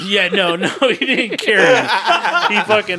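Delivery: loud, laughing denial; laughing correction. Real, not acted. Storyteller correcting his friend's teasing, laughing. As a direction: correct him loudly through laughter.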